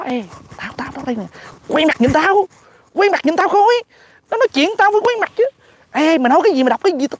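mock calling out, starting small then climbing high; high-pitched comic pestering; Netanyahu. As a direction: imitate someone insisting on eye contact. Voices Netanyahu trying to get attention.

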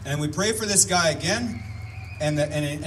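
ordinary talk, nothing marked

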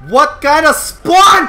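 loud, high, slow indignant shout; outraged shout. Real, not acted. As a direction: stretch it out in disbelief. Outraged at game spawn.

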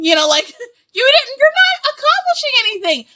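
high, laughing through the words; cracking up mid-rant. Real, not acted. Ranting about Cheyenne while cracking up, laughing.

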